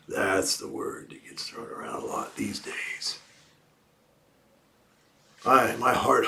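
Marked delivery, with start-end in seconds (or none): 0.1-3.2 low, whispered cowboy talk. Pretend. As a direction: keep up the hushed cowboy whisper. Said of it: "in my best cowboy voice".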